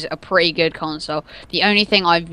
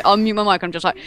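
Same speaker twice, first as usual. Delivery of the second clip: ordinary talk, nothing marked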